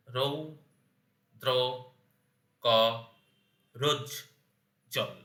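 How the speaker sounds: রৌ, দ্র, ক, রোজ, জল, each alone with a long pause; syllable by syllable. Shown as a demonstration. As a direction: break it into five isolated beats: রৌ, দ্র, ক, রোজ, জল. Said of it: "এর যদি আমি দল বিশ্লেষণ করি".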